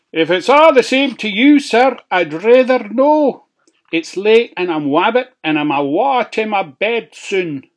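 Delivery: high, loud, fast pig; politely cheeky pig. A narrator's reading. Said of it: "pig declines politely".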